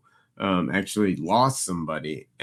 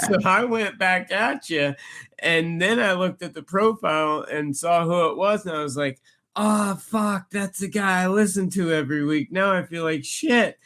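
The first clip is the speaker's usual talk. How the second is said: sheepish fan; 9 semitones higher than usual. Retold or imitated. Retelling a fan's apology.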